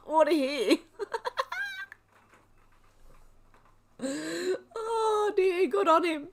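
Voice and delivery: high, helpless laughter through the words; real tearful laughing fit; laughing to tears. Real, not acted. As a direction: barely get it out for laughing. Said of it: "I'm laughing so much".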